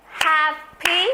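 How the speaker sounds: Happy said in two bouncing beats, hap-py; Happy split into two beats, hap and py; rhythmic syllable beats. Shown as a demonstration. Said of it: "Two syllable. It is rhythmic".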